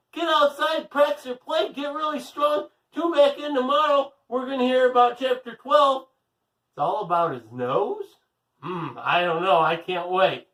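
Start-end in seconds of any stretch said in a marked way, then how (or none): 0.1-6.1 high, loud cheerful host call; cheery sign-off. Real, not acted. Host saying goodbye to young listeners, bright high call.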